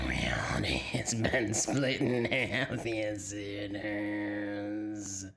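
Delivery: eerie unvoiced demon whisper, dragged out; spooky whispered demon voice; creepy whisper. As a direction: hiss it like a lurking demon. Creepy demon voice over recording.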